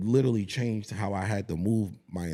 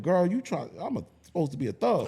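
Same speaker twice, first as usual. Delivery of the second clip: mock protest; 8 semitones higher than usual. Playfully retold protest to his daughter.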